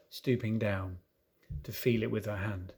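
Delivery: ordinary delivery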